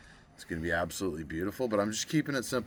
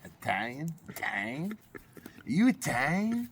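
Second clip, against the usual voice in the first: put-on rising New York Italian accent, climbing much higher and slower each time; mock a New York Italian accent, building up; hammed-up New York Italian accent asking Italian?; 10 semitones higher than usual. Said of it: "That's how they say it in New York, eh?".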